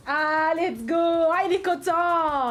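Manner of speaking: loud, slow, stretched "il est content"; delighted cheer. Real, not acted. Streamer cheers a viewer who just subscribed, slow and loud. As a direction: draw it out with delight.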